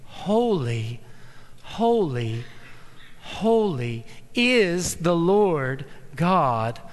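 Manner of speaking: solemn proclamation. Acted. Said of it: "this kind of attention".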